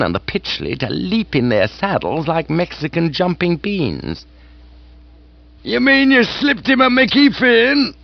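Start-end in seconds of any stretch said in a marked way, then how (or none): 5.6-7.9 higher, loud hearty aunt cry; hunting-field yowl. Acted. Aunt astonished at Jeeves's trick. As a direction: whoop it out. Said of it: "gave the sort of cry or yowl".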